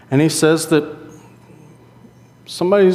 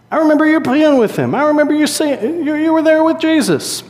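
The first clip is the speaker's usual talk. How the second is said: much higher-pitched mock accuser; insistent accuser; 13 semitones higher, 3 dB louder than usual. Onlooker accuses Peter by the fire, in a high insistent voice. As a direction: pitch it way up, pointing the finger at him.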